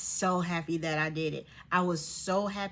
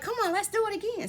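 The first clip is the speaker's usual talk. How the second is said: high, quick, excited imitation; eager urging; faster than usual. Friends urging her to ride again, excited. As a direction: rush it out way up high, egging someone on. Ladies urge another ride.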